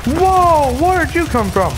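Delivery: high, loud jolt of surprise; a loud, high startled cry at the sudden enemy; startled shout. Real, not acted. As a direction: yelp as if ambushed.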